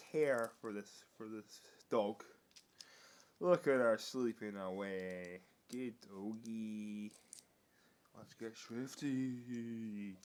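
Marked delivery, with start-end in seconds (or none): 5.7-10.2 soft slow praise. Real, not acted. Soft praise to sleeping dog.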